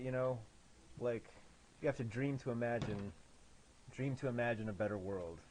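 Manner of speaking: ordinary delivery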